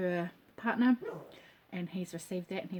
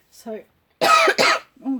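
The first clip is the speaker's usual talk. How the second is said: cough; 3 semitones higher, 13 dB louder than usual. Real, not acted. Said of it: "excuse me".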